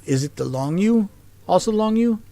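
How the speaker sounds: ordinary delivery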